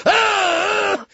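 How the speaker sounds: high, loud yell of glee; wild party whoop. Retold or imitated. Farley whoops at the strip club, high loud yell. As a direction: let out a rowdy whoop.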